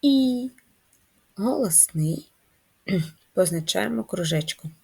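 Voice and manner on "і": І held by itself, higher; І as one sustained vowel sound; isolated sound. Shown as a demonstration. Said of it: "Другий звук".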